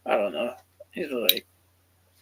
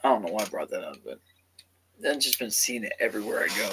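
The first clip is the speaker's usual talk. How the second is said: ordinary talk, nothing marked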